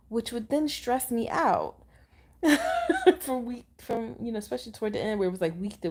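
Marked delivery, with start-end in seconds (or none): 2.4-3.1 quick, loud laugh; short high laugh. Real, not acted. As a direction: burst of high-pitched laughter. Laughs while recalling her stress.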